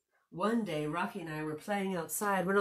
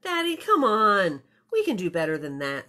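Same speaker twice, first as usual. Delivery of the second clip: loud, quick urging of dad; impatient plea; 2 semitones higher, 10 dB louder than usual. Acted. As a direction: push dad loudly and fast to do better. Girl pushes Daddy to try harder.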